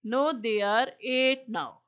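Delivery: slow, loud, deliberate read-aloud; dictation reading. Acted. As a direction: spell out each word for the class. Teacher reads textbook dialogue aloud.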